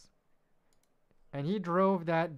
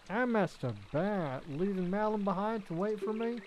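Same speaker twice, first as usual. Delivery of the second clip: higher, rustic, worried farmhand; higher country accent, panicking about being late; farmhand character voice; 5 semitones higher than usual. Acted. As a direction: play a sleepy ranch hand who messed up, fretting. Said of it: "butchering whatever accent I was trying to do".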